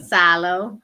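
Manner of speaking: loud Southern "Sah-lo" for silo; drawn into a Southern twang, "Silo" with an "ah" in the first syllable; Southern accent. Shown as a demonstration. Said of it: "say it with a Southern accent".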